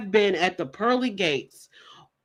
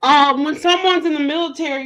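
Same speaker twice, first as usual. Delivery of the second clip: ordinary delivery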